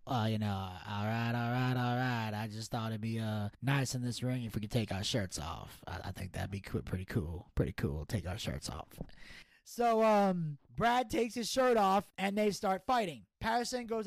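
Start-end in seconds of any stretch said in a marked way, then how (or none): 0.1-8.9 lazy Texan cool guy; low drawled McConaughey voice; McConaughey impression. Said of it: "Matthew McConaughey".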